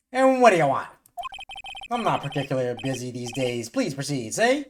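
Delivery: Grossberg voice. Acted. Voicing Grossberg.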